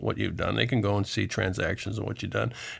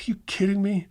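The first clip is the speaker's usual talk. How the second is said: mock disbelief; 9 semitones higher than usual. Echoing client's disbelief.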